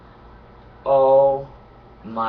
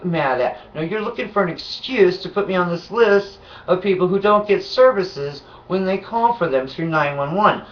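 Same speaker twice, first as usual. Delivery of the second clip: higher, heated rant at him; imagined angry rant; 6 semitones higher than usual. Retold or imitated. What she wanted to tell the paramedic, heated and higher. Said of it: "in the back of my head, I'm like".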